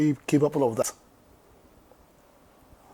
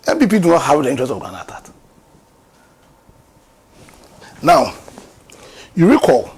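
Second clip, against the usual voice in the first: heated loud rant; 3 semitones higher, 9 dB louder than usual. Real, not acted. Heated accusation, raised voice.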